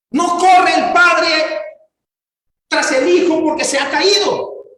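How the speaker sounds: voz alta y encendida, con lentitud dramática; predicación a plena fuerza. Acted. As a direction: clama el dolor de la cruz ante la congregación. (predicador clamando con pasión)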